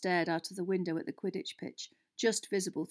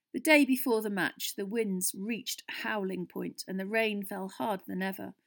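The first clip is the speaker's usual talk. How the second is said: usual speaking voice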